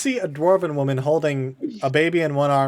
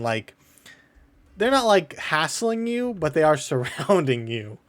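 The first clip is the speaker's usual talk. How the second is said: usual speaking voice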